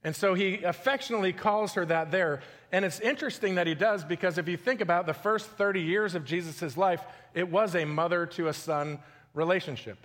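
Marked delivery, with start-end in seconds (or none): none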